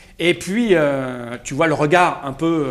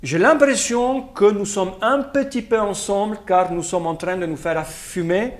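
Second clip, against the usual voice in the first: put-on Russian accent; 4 dB quieter than usual. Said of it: "Avec un accent russe".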